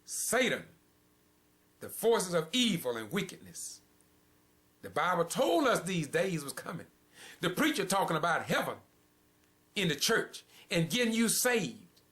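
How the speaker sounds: thundering pulpit warning; fiery preaching. Acted. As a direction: preach it out with full force. Preaching at full force.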